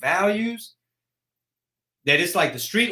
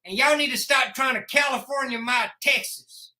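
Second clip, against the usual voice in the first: high-pitched Texan scolding; mock Texan local; 8 semitones higher than usual. Texan telling newcomers to stop changing Texas, mock scolding. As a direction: scold them as a put-on Texan.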